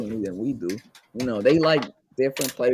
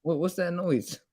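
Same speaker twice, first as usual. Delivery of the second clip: ordinary talk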